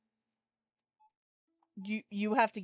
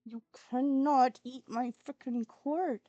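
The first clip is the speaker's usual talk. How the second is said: slow, loud, emphatic telling-off; stern scolding; 3 semitones higher, 6 dB louder, slower than usual. Real, not acted. Warning the kitten off the cord, stern scolding. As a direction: drive each word home to a naughty pet.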